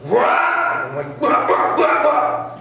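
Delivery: high, loud yelping wah sounds; loud cartoonish wah-wah noises; car wobble noise. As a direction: imitate the car swerving crooked. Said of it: "the car was like".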